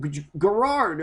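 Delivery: normal talking voice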